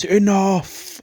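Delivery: high, drawn-out mock yell; mock scream. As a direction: imitate a boss yelling over a squabble. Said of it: "Joe sort of screams".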